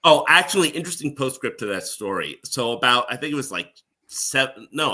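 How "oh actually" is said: ordinary talk, nothing marked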